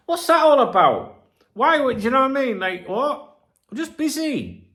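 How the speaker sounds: high, loud, incredulous outburst; indignant disbelief. Real, not acted. Vlogger baffled by a friend's message, high indignant outburst. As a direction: throw the question up in disbelief.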